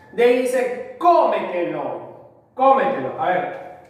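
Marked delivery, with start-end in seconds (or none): 1.0-3.2 cómetelo with a strong, held CÓ; CÓ-metelo, sounded out for a class; stress on first syllable. Shown as a demonstration. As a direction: punch the CÓ, let metelo fall away. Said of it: "tiene la mayor fuerza de voz en co".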